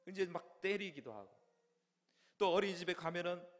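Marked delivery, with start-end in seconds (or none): none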